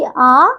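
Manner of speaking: आ held for two beats; long vowel. Shown as a demonstration. Teacher showing pupils long vowels, held आ. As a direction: draw out आ evenly. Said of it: "ह्रस्व स्वर से दुगुना समय".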